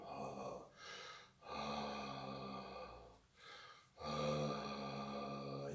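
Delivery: low, soft sustained ah; vocal teacher's low tone demo; low drawn-out ah. Shown as a demonstration. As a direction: let the ah sink low. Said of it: "You can get lower".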